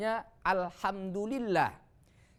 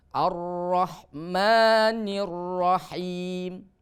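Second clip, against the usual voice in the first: very slow recitation, long held final syllable; Quran recitation demo; 4 dB louder, much slower than usual. Shown as a demonstration. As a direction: draw out the long vowels evenly. (bacaan tartil, mad dipanjangkan)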